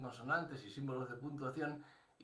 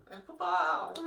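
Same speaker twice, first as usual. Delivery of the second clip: put-on high, loud cry of a child; excited greeting; 9 dB louder, slower than usual. Children running to open for their father, high excited shout. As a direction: shout it out as Dad turns up.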